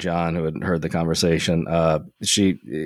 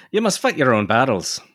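higher-pitched Irish character voice; stage-Irish lilt; Irish accent; 7 semitones higher than usual. Acted. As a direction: read it in a caricature Irish brogue. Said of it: "nineteenth century caricatures of Irish Americans".

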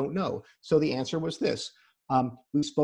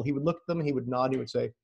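ordinary talk, nothing marked

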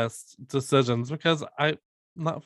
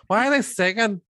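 mock complaint; 8 semitones higher, 4 dB louder than usual.

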